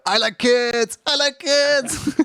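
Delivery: high-pitched cartoon character voice; Pim impression. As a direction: squeak it out high, stretching the last one. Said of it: "that's Pim. That's not even Charlie. The only voice line in my head".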